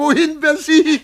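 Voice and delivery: talking high through giggles; can barely contain the joke; delighted, laughing voice; gleeful laughter. Acted. Teasing the secretary.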